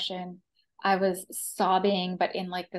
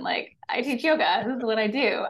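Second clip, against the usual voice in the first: higher and louder retold brush-off; mock dismissive; 3 semitones higher, 4 dB louder than usual. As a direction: play up an indignant yoga teacher's retort. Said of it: "I was really like".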